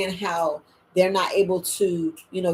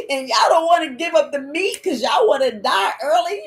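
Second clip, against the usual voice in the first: gasping, louder half-sobbed plea; mock crying rant; 6 semitones higher, 4 dB louder than usual. Passionate speaker imagining crying at her audience, a hammed-up sob. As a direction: sob and scold at once. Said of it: "I'd be sitting here crying like".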